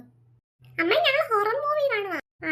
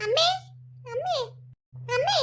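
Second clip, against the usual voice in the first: short, rising calls; calling out; 7 semitones higher than usual. Acted. Girl alone at night checking for mother, calling out. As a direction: call out for mother, rising each time.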